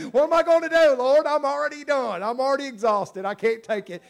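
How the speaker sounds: mock exasperated cry to the Lord; mock panicked believer. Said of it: "I'm already out of resources".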